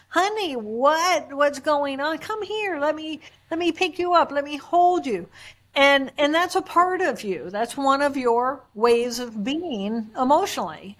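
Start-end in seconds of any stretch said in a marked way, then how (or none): 0.1-5.3 put-on higher, caring coaxing to a child; compassionate father. Father comforts his little girl, lightly acted tenderness. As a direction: scoop her up with your voice, gently imitated. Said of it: "You immediately move into your compassion".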